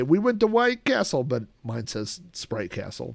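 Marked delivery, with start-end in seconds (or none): 0.0-1.2 higher, shouted rap-style lyric. Retold or imitated. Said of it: "normally they would say".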